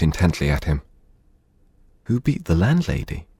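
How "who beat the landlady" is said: higher, puzzled servant woman; lightly voiced maid asking back; Nastasia voice. A narrator's reading.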